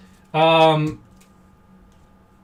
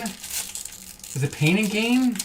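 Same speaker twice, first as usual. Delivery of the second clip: drawn out, softer, pondering; slow musing; 4 semitones higher, 5 dB quieter, much slower than usual. Real, not acted. Streamer to chat, slowly pondering the title. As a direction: stretch it out as you search your memory. Trying to recall the movie.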